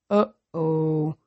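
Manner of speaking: slow, dopey giant uh-oh; giant's uh-oh. A narrator's reading. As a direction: let it out like a clumsy giant. Giant says uh-oh after breaking things.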